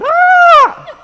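loud, very high mock shriek; pretend scream. Man jokes about seeing mouse in shower, pretend scream. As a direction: fake a terrified scream for laughs. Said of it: "I screamed".

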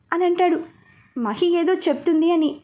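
ordinary delivery

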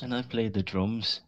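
mock Liverpool accent, drawn out. Joining a friend's drummer bit, mock Liverpool accent. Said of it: "My name's [name]".

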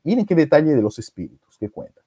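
ordinary delivery

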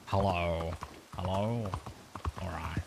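low, slightly softened wallaby character voice. Shown as a demonstration. Streamer warming back into the wallaby character, lightly voiced wallaby. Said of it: "I gotta do the wallaby voice".

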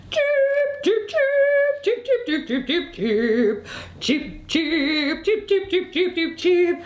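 high, piercing cricket-like cheeps; cheeping grasshopper. Acted. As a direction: chirp it high in little bursts. Grasshopper's cheeping interrupts Miss Mouse.

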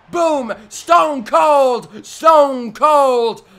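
a loud, high announcer-style yell; hyped shout. Real, not acted. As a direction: call the finisher like a ring announcer. Hyping the finishing move.

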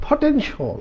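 potential said high and soft; teaching model. Shown as a demonstration. Teacher models potential for learners, high and soft. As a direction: lift potential high, gently. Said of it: "it's a".

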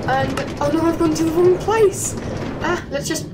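sudden panic at a mistake; alarmed. Real, not acted. Falling into water in game.

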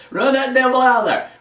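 laughing, high call to chase the devil out; high laughing preacher cry. Real, not acted. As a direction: laugh it out and cast him out.

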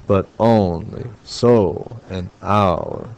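very slow, stressing "on", "so" and "hour"; iambic scansion. Shown as a demonstration. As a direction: beat out "But only so an hour" as three drawn-out iambs. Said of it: "I'm looking for three iambs per measure".

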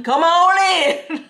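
high, loud, stretched-out call; hollered welcome. Acted. American gatekeeper waving people in, hollered welcome.

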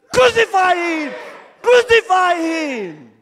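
loud, high mob yell. Pretend. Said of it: "They all shouted even louder".

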